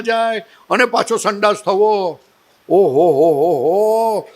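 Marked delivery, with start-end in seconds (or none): 2.7-4.3 slow, low drawn-out oho of wonder; amazed oho. Real, not acted. Marvelling at the body's process, a slow low oho. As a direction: marvel at it, stretching each ho.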